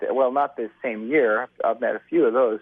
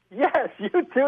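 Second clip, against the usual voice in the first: laughing reply; slower than usual. Retold or imitated.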